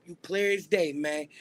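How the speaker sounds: ordinary talk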